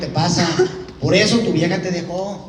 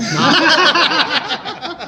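high, loud laughing fit; real cackling laughter; 9 semitones higher, 4 dB louder than usual. Real, not acted. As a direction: burst out laughing at the jab. Laughter after final jab.

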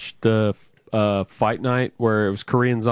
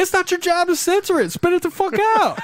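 mock yell. Shouting at the translator.

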